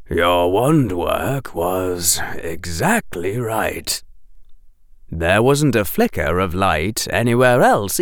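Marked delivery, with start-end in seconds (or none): 0.1-4.0 low, slow kind teacher; a kind professor breaking bad news; gentle teacher voice. A narrator's reading.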